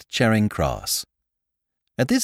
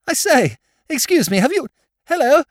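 far higher and louder, calling out; eager young lord; 5 dB louder than usual. Acted. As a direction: hail him brightly, a silly-ass toff. Lord Drever accosts Jimmy at night.

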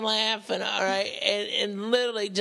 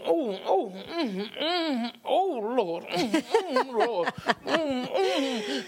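higher humming moans of bliss over a steak; hammed-up churchy food ecstasy, drifting into laughter; mock praising Jesus; 4 semitones higher, slower than usual. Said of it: "he's like praising Jesus".

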